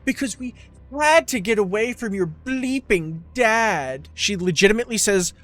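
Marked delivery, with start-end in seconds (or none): 0.0-4.1 lightly hammed-up bad actress mom; mocking the mom. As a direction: parody her stilted line delivery.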